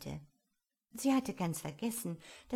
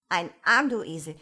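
loud, scornful outburst; indignant, hard-hit first words; berating; 11 dB louder than usual. A narrator's reading. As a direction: explode at a foolish servant. Rabbit berates its servant.

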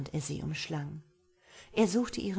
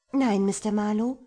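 a stubborn "Nein", higher and louder; 5 semitones higher, 10 dB louder than usual. A narrator's reading. Violet refuses Marlowe, stubborn and raised. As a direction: dig in and refuse him. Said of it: "sagte sie eigensinnig".

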